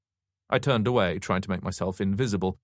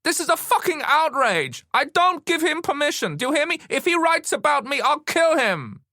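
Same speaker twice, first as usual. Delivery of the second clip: shrieky high-pitched tirade; screaming with anger; 6 dB louder than usual. A narrator's reading. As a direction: scream threats, more high than loud. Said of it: "She was screaming".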